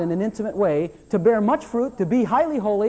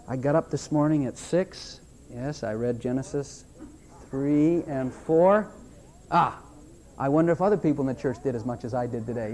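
mock smug churchgoer; 8 semitones lower, 5 dB quieter than usual. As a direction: lower and softer, a smug self-pleased mumble. Mocking a self-satisfied churchgoer.

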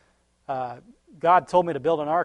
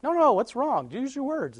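higher, gentle asking; coaxing parent; 9 semitones higher than usual. Retold or imitated. Parent asking a sick crying child, coaxing. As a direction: coax a crying kid to talk.

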